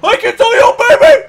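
loud, high hype yell; excited shout. Real, not acted. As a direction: scream encouragement at teammate. Cheering teammate on.

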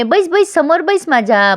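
high-pitched, lightly mock-gracious urging; acted condescending invitation; patronising coaxing. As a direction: coax them to sit, pitch lifted.

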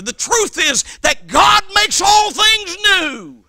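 high, slow, shouted proclamation; shouted climax. Acted. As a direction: hammer each word home.

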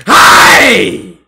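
loud triumphant yell; excited shout. Real, not acted. Gamer hyped over a big play.

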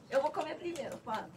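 ordinary delivery

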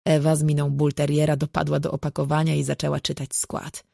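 plain reading voice

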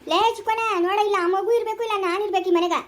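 high-pitched angry threat; angry ultimatum. Acted. Threatening to leave home over the child, angry. Acted ultimatum in family quarrel.

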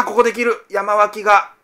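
excited shout that cracks; voice cracking. Real, not acted. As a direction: voice flipping high and loud. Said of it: "声が裏返ってしまいました".

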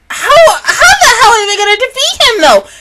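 high, loud disbelieving laugh-shout. Real, not acted. Wondering how the heroes can win, loud and laughing. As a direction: throw up your hands in disbelief. Exasperated about impossible odds.

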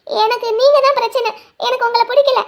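put-on a girl shouting at her lover to release her; angry outburst. She shouts at him to let go.

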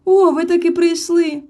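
high, loud glad cry; joyful greeting. A narrator's reading. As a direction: greet them with delight. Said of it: "зрадів Мітроль". (радісний вигук)

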